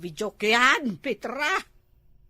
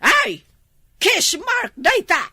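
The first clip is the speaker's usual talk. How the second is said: loud, high-pitched accusation; outraged discovery; 5 semitones higher, 6 dB louder than usual. Acted. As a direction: cry out as you catch your husband out. Wife spots a kiss mark. (nakapungtot a pammabasol)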